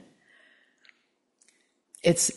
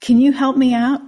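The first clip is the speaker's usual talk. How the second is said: higher, louder little frog voice; small pleading frog; 7 semitones higher, 9 dB louder than usual. A narrator's reading. Frog begging the passing man for help, higher and louder. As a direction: call up to him as the frog. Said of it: "he hears".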